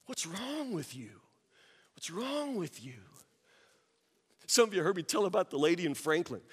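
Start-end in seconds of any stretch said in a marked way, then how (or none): 0.0-3.0 very soft, baffled question; quiet, pointed reproach; hushed dismay. Acted. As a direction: ask it under your voice, appalled.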